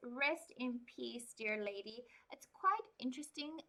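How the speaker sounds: plain reading voice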